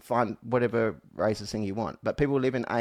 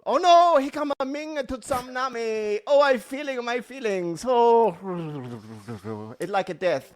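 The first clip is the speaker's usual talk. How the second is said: put-on high, wailing caricature of a Japanese accent; bad Japanese accent; 9 semitones higher, 4 dB louder, slower than usual. Crying tsunami of feelings to co-host, bad Japanese accent. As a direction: ham up a bad Japanese accent, loud at first. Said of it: "What about if I do it really badly?".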